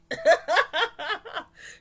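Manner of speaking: high delighted laugh. Real, not acted. Laughing at a viewer's Jeopardy-style answer. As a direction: laugh with glee.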